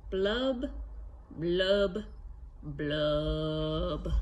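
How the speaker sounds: fish blub sounds. Acted. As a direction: blow the blubs out like a fish's bubbles. Fish's refrain read to kids.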